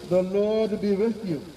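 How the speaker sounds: ordinary delivery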